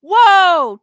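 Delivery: loud, high startled whoa; shocked whoa. Real, not acted. Streamer startled by a chat comment, loud shocked whoa. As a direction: jolt back at the news.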